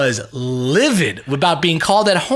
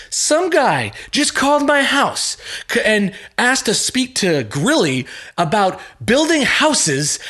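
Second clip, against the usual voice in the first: livid caller; 11 semitones higher than usual. Retold or imitated.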